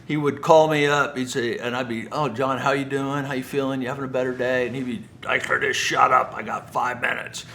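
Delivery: ordinary talk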